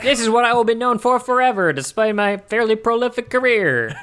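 high, loud laughing actor voice; mock lament.

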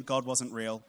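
normal talking voice

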